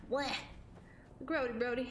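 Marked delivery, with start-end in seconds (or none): 0.1-0.3 quick "blech" of disgust; mock retch. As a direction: spit out a playful gag. Repeats the disgusted noise.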